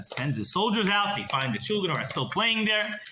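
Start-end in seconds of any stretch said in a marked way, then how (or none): none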